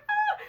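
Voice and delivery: high squeal. Real, not acted. Burst after con culture rant.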